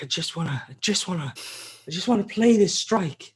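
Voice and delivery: put-on pleading like a kid who wants to play; mock whiny gamer. Imitating himself begging friends to let him play, mock whiny. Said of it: "I was like".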